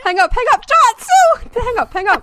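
high, loud frantic yelling; panicked shout. Real, not acted. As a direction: yell in a laughing panic. Panicking at creepy caller.

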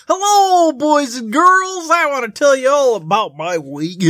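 high, loud goofy clown voice. Acted. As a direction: squeal it like a party clown. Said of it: "Never clown voice".

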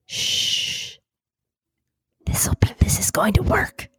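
hushed conspiratorial whisper. Real, not acted. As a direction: whisper your clever plan. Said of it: "you're gonna whisper".

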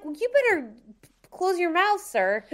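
high mock warning to shut his mouth; mock scolding. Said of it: "I'm like".

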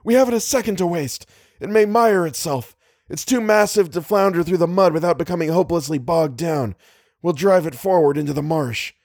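high, urgent insistence; emphatic. A narrator's reading. Leader urges driving it into the marsh, emphatic. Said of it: "Little's reply was emphatic".

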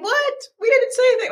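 high-pitched imitation of baffled people; mock innocent protest. Retold people protesting their innocence.

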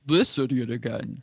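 lightly done Optimus Prime, scornful. Retold or imitated. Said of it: "Sounds like Optimus Prime".

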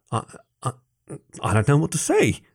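stammer, lost for words; speechless. A narrator's reading.

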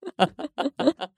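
short high burst of laughter. Retold or imitated. Husband laughing at device.

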